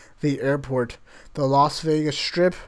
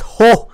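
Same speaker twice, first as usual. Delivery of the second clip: one loud, high hammed-up ho laugh; 6 semitones higher, 10 dB louder than usual. Sam laughing at Fergus's try, loud hammed-up ho. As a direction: blurt out a big ho. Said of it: "Sam laughed".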